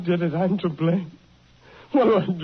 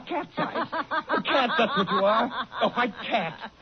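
mocking laughter; 5 semitones higher than usual. Husband taunts his wife, laughing.

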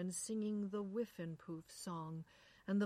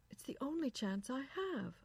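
higher-pitched wife defending herself; woman on radio; 7 semitones higher than usual. A narrator's reading. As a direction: lift the voice for a protesting wife. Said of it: "a woman said".

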